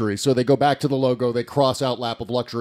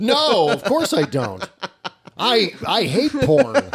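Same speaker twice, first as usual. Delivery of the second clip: laughing; 9 semitones higher, slower than usual. Real, not acted. Laughing denial to wife.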